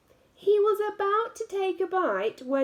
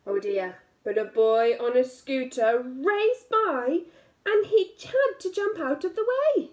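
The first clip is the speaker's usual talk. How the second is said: ordinary delivery, nothing marked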